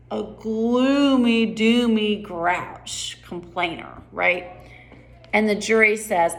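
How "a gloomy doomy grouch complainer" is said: very slow, stretched vowels; drawn-out disdain. Acted. Queen's line about who nobody wants, slow and drawn out. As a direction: stretch out each gloomy word. Script line mocking gloomy grouches.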